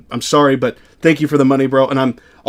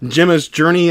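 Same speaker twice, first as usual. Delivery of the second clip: ordinary talk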